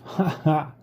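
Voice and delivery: quick high cackle of a laugh; amused woodpecker laugh from above; short shrill laugh. A narrator's reading. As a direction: bark out a brief mocking ha ha. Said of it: "short, shrill laugh".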